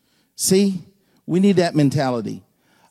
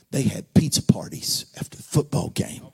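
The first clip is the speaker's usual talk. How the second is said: hushed whisper; 3 semitones lower than usual. Real, not acted. Preacher whispering about the rival church, hushed. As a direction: let them in on a secret. Confiding aside continues.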